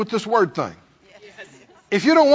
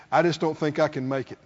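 mock defeated sigh; 8 semitones lower, 7 dB quieter, faster than usual. Preacher imagining Jesus voicing defeat, mock defeated and soft. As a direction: lower and softer, a deflated give-up line. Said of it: "if Jesus got up one morning and said".

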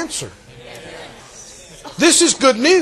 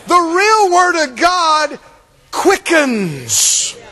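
preaching at full force; 4 semitones higher, much slower than usual. Acted. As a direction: ring it out from the pulpit. Preacher proclaiming.

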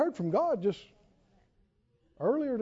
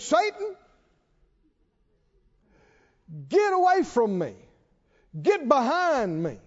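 Jesus rebuking Satan; 4 semitones higher than usual. Retold or imitated. Jesus to Peter, commanding sharp rebuke. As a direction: cast Satan away with force. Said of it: "Jesus turned to Peter and said".

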